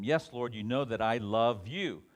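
loud, emphatic avowal; earnest affirmation. Acted. Peter answering Jesus after breakfast, loud earnest affirmation. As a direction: insist on it with force.

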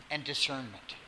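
ordinary talk, nothing marked

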